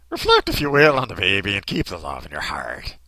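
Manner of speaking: hammed-up Irish priest, dropping in pitch; old Irish voice. Priest urging mass to reflect on the baby, old Irish accent. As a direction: preach it in a bad cartoon brogue. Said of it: "There's an old Irish voice".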